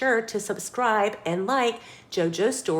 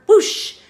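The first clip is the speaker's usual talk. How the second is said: loud high whoosh; 12 semitones higher, 9 dB louder than usual. A narrator's reading. Jet launches, loud high whoosh. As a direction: belt out a whoosh sound effect.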